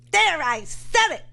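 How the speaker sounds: a mild mock of the radio host; imitating Mark Levin. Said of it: "you think when I imitate him".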